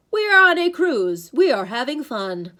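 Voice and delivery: loud, stilted, programmed cheer; entranced dad. A narrator's reading. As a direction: recite it like a hypnotized father. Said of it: "his eyes glazed".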